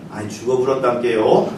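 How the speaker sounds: Jeolla dialect with a chuckle; lightly voiced Jeolla dialect, amused. Shown as a demonstration. 전라도 사투리로 웃으며. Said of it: "전라도에서는 어떻게 말합니까?".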